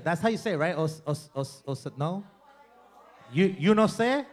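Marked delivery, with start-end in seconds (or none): none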